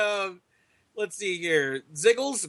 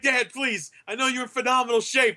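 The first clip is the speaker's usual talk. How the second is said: higher-pitched imitation of an embarrassed son; pleading son; 6 semitones higher than usual. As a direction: plead with your dad to behave. Acting embarrassed son pleading with dad.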